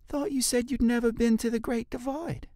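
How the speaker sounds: suspicious leader. Acted. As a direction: catch him in his lie. Jolie catches the lie.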